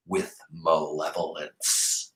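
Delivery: slow, soft, drawn-out malevolence; savored word. A narrator's reading. Teacher reading to kids, slow and quiet. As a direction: linger on malevolence.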